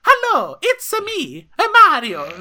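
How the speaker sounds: put-on high, loud Super Mario Italian accent. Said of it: "Super Mario".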